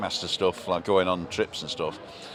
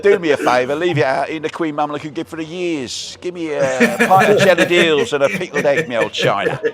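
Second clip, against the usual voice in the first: put-on high, loud comic Cockney London accent; jokey London accent, voice raised; hammed-up London accent; 7 semitones higher, 6 dB louder than usual. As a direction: ham up a London geezer's patter, pitched well up. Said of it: "I can do the London accent".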